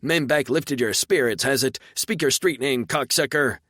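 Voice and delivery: loud, high, furious driver's yell; irate yelling. A narrator's reading. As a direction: bawl him out from the wagon in the rain. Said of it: "yelling irately".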